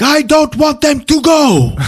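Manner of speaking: high, loud, slow hammed-up yell; yelled refusal. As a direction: shout her down. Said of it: "He fucking yells at her".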